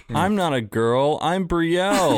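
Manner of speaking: put-on slow, insistent little-kid protest, rising high; Brielle as child. Sibling imitating little sister's protest, drawn-out and insistent. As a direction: imitate a stubborn tiny girl. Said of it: "it's little tiny Brielle that said".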